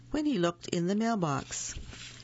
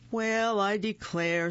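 a lightly voiced gruff bear, slow; slow, big bear drawl; 5 semitones higher than usual. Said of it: "said a big, gruff voice".

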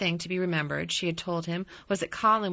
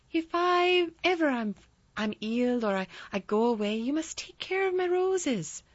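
light laughing woman; 8 semitones higher than usual. Retold or imitated.

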